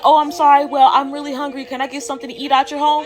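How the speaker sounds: mock sweet lady asking a favour; sweet lady in distress. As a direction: imitate a needy, polite stranger. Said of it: "like, some sweet old lady in distress".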